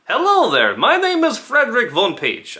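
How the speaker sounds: high, loud comic foreign accent; Borat. Shown as a demonstration. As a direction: boom it out in a hammed-up, high-pitched accent. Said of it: "sometimes it would sound like Borat".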